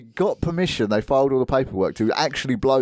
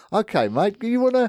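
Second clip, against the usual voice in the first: higher-pitched, badly faked New Zealand accent; attempted New Zealand accent; 8 semitones higher than usual. Podcaster imitates a cop talking to a demon, shaky New Zealand accent attempt. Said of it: "I can't do it, the accent".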